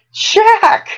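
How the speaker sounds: very high, loud cry of wonder; delighted exclamation. A narrator's reading. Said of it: "she exclaimed".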